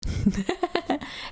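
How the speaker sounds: quick breathy laugh; giggle. Real, not acted. Laughing after greeting.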